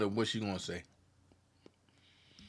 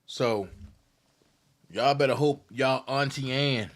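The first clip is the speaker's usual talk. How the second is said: ordinary talk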